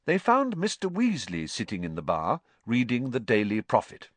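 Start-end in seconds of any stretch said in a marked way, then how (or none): none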